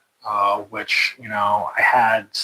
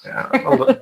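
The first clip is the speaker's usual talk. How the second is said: laughing; 4 dB louder than usual. Real, not acted. Admits joking, laughing.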